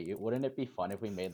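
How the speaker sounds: normal talking voice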